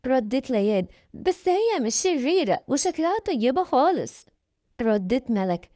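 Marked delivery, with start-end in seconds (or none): 1.1-4.3 put-on high, childlike pleading; little girl's voice. Youngest sister insists the woman is kind, high childlike voice.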